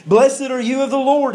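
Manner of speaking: high, eager exclamation; acting out an excited Saul; excited greeting. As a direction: greet him with excitement, pitch lifted well up. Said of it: "He was excited when Samuel came".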